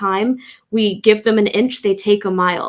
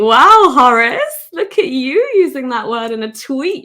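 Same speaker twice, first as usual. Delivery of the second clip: mock admiration; 3 semitones higher, 4 dB louder than usual. Mock praise of the tweeter.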